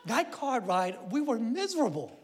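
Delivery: normal talking voice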